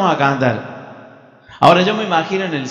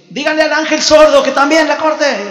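high, loud complaint; exasperated yell; 10 semitones higher, 6 dB louder than usual. Acted. God silencing the deaf angel still playing, exasperated yell. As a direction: yell it across heaven, hammed-up annoyance.

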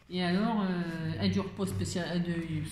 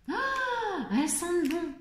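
very high oh then higher praise; delighted; 8 semitones higher, slower than usual. Real, not acted. Vlogger gushing to viewers about candles, high delighted. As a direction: gush over the smell.

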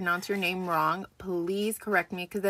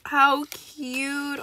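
ordinary talk, nothing marked

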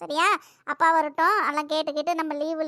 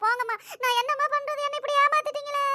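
whining; 10 semitones higher than usual. Acted. Child sulks at mother.

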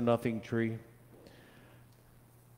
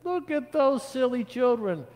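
very high put-on scoffing voice; mocking onlooker; 4 dB louder than usual. A narrator's reading. People shake heads at children, high mocking voice. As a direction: jeer in a high-pitched character voice.

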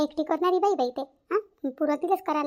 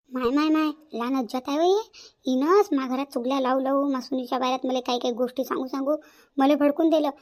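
ordinary talk, nothing marked

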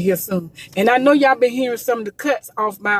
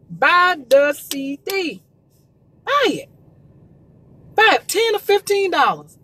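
emphatic urging; 4 semitones higher than usual. Real, not acted. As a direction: drive it home to the viewers. Urging viewers to buy CDs.

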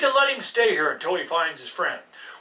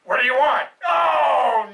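ordinary delivery

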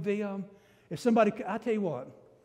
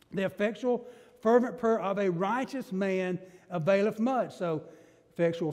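usual speaking voice

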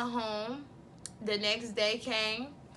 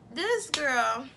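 usual speaking voice

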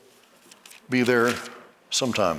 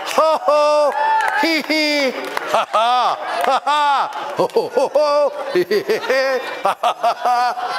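mocking laughter; 3 semitones higher than usual. Shown as a demonstration. Preacher laughing at the devil's lies. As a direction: laugh off the doctor's report. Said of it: "Your body says what?".

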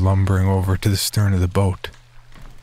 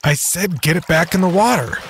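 angry; 10 semitones higher than usual. Acted. Doctor orders boatman angrily.

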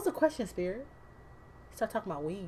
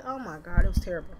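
ordinary talk

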